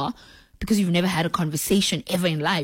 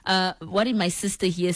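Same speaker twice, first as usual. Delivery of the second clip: ordinary talk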